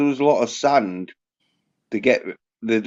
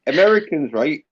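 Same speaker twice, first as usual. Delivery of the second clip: ordinary talk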